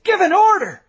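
very high, loud plea; desperate shout. A narrator's reading. Tom desperately shouts at the colonel to give an order. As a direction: scream for an order.

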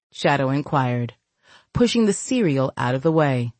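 plain reading voice

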